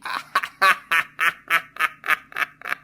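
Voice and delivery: long staccato acted laugh; extended mocking ha-ha-ha; bursting into laughter. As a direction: burst out laughing at a silly sight. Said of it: "हंस पड़ता है".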